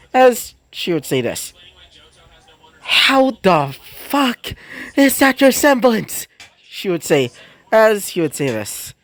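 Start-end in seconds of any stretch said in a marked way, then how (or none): 2.8-6.3 high, drawn-out disbelieving cry; can't believe what she just saw; incredulous outburst. A narrator's reading. Harmony shocked at the Tsar's semblance, drawn-out high disbelief.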